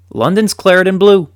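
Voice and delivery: high, loud, triumphant proclamation; proud announcement. Real, not acted. Fan proudly telling viewers West Ham rules London, triumphant. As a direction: announce it like a stadium chant. Big reveal of West Ham's success.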